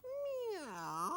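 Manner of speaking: very high mewing meow; high playful meow; cat meow. Acted. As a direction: mew like a little cat. Sock cat speaks.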